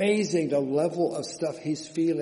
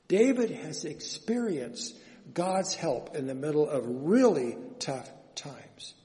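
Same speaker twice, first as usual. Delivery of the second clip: normal talking voice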